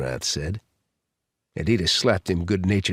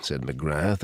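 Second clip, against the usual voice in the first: ordinary delivery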